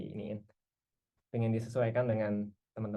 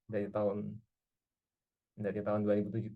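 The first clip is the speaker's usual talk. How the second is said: ordinary talk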